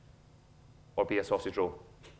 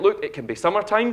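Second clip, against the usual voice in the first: a higher mock child voice, eager; excited little boy; 9 semitones higher, 8 dB louder than usual. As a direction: show off the idea like a proud four-year-old. Son's words retold.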